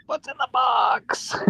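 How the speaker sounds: high-pitched mock shout, held back in volume; hammed-up, strained high yell; anguished movie cry. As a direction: cry it out like a desperate film hero. Acts out the famous film line.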